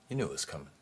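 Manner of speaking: normal talking voice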